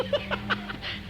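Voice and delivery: airy high laugh; high breathy laugh. Real, not acted. Preacher laughing after mocking the congregation, airy laugh.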